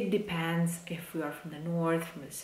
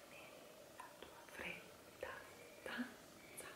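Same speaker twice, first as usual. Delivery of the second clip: whispered practice; 3 semitones higher, 27 dB quieter than usual. Shown as a demonstration. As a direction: whisper nella tua fredda stanza.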